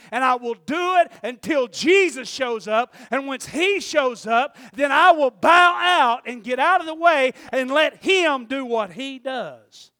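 loud, high, drawn-out proclamation; full-force preaching. Real, not acted. Preacher explaining why John was called, full-force preaching. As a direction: drive it home at the top of your voice.

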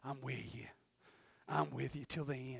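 very soft, low imitation of God's voice; still small voice. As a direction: barely voice it, as quiet reassurance. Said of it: "that still small voice go".

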